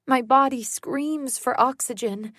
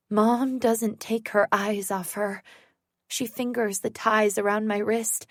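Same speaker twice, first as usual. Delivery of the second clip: plain reading voice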